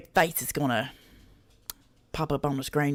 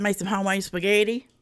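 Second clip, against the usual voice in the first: usual speaking voice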